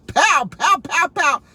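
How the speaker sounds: loud, high mock pow pow; gunshot noises. Imitating Yosemite Sam's guns, loud mock pow. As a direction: shoot like a cartoon cowboy.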